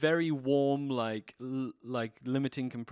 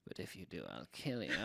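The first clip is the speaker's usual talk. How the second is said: low, quiet mock threat; mock menacing threat; 6 semitones lower, 10 dB quieter than usual. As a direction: growl a joking threat under your breath. Joking threat after "steal.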